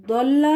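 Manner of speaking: emphatic Daad opening ضل; heavy Daad. Shown as a demonstration. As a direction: lean on the heavy d of ضل. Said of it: "داد کے ساتھ".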